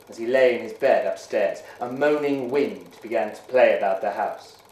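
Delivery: ordinary delivery, nothing marked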